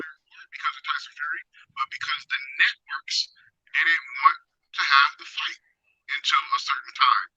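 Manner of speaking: muffled, distant line; like talking from a hole; far away. Real, not acted. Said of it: "You sound far away".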